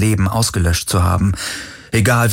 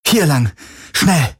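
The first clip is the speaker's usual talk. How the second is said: higher, pushing him to run; urgent call; 7 semitones higher than usual. Acted. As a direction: hurry him along, tense. Kirai drängt Galen zur Flucht. (drängend, höher gerufen)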